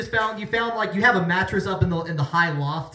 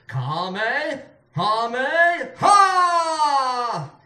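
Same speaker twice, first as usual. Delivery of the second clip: belted-out attack cry; 4 semitones higher, 4 dB louder, much slower than usual. A narrator's reading. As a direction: build in steps to a long, loud, high shout. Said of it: "as he belted out the words".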